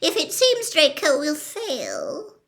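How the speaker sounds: high, urgent woman's voice, pleading; Narcissa's whispered plea. A narrator's reading. Narcissa pleads with Snape for her son, high and urgent. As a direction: press Snape with hushed urgency. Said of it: "whispered Narcissa".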